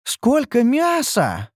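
high, drawn-out cry of rapture; slow, soaring enthusiastic squeal; delighted exclamation. A narrator's reading. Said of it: "восторженно воскликнула Ниуру".

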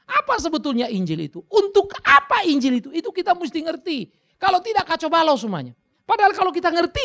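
high, loud preaching at full force; fiery preaching. Acted. (berkhotbah dengan berapi-api, keras dan tinggi)

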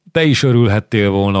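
ordinary delivery

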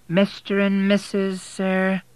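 drawn-out suspicious question from the desk; suspicious receptionist. A narrator's reading. Receptionist doubting William and Kate are married, slow and high.

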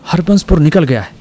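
high, loud excited call; excited cry. A narrator's reading. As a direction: blurt it out, thrilled. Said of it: "ऊंची आवाज में बोला".

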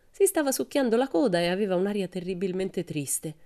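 plain reading voice

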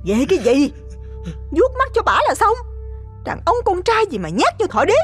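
contemptuous wife. Acted. Wife mocks cowardly husband.

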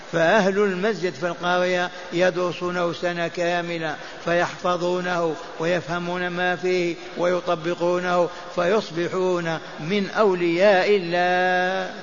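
ordinary delivery

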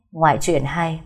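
plain reading voice

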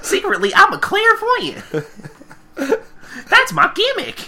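very high, laughing squeal; hammed-up confession through laughter; mock revelation. Host riffing as Applejack, high squealed mock revelation.

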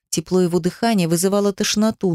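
usual speaking voice

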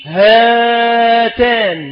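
هاتان drawn out very slowly; slow dictation. Shown as a demonstration. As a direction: stretch هاتان so students hear the alif. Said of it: "وإنما تكتبها". (مد كلمة هاتان ببطء شديد)

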